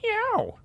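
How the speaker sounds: very high squeaky meow; mock cat meow. Streamer mocking the cat voice actor for chat, high squeaky meow. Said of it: "sounds like meow".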